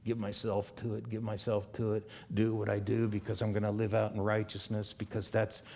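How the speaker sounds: hushed, half-mocking devotion; quiet mock inner voice. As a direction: mutter it like a keen believer to himself. Mock eager believer's inner voice.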